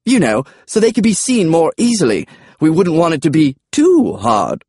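high comic dinosaur voice; high-pitched, talkative dinosaur; dinosaur Charles. Acted. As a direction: explain it in a high creature voice.

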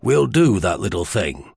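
lightly voiced Chisholmian accent; a hint of a Chisholmian sailor's accent. A narrator's reading. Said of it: "had a Chisholmian accent".

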